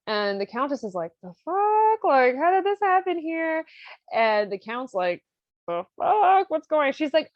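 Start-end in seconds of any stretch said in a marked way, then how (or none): 1.2-3.6 very high, loud disbelief; hammed-up astonishment, voice shooting up; mock shock. Countess finds Susanna instead of the page, shocked and high. 5.7-6.9 high, loud startled question; mock bewilderment. Count opens the closet on Susanna, bewildered and high.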